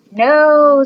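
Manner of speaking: loud, defiant group no; girls shouting no. A narrator's reading. Girls answering the teacher with a loud no. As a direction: bark out a loud refusal. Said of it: "said all the girls".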